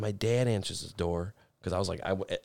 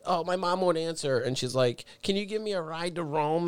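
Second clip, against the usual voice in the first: put-on higher, pleading young girl; girly voice; 7 semitones higher than usual. Mimicking the stranded girl asking him for a ride higher. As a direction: beg for a ride in a small girl voice.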